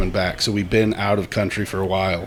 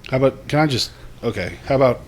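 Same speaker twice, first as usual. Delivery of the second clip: ordinary talk, nothing marked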